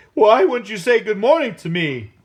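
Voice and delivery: loud, high snake retort; demanding snake. A narrator's reading. Loud demanding python. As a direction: python demands an answer from iguana. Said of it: "demanded the snake".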